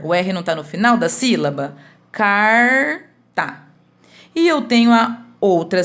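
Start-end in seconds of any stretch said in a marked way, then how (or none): 2.1-3.6 CARTA split as long CAR, pause, short TA; syllable by syllable. Shown as a demonstration. Teacher repeating the separated syllables for pupils, word broken in two. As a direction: stretch CAR in CARTA, then drop TA separately. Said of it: "eu separei a sílaba".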